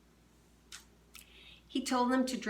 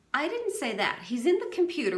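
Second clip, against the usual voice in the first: defensive raised voice; 9 semitones higher, 6 dB louder, faster than usual. Acted. Attorney General defensive, raised voice.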